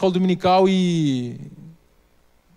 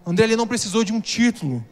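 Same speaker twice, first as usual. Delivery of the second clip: louder pulpit appeal, pressing the question; impassioned preaching; 3 semitones higher, 3 dB louder than usual. Acted. Pastor challenging the church to pray for a friend, fervent preaching.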